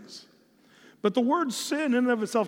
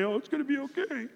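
mock comfort; 7 semitones higher, 4 dB quieter, faster than usual. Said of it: "put your arm around me and say".

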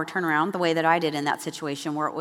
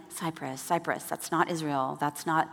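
much softer, low, taken aback; wife quietly processing the news, much softer; stunned mutter; 3 semitones lower, 7 dB quieter than usual. Retold or imitated. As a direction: mutter it to yourself in disbelief. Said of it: "I'm like".

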